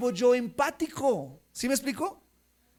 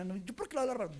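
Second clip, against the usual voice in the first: quiet denial; 10 dB quieter, much faster than usual. Retold or imitated. Retold sister's denial.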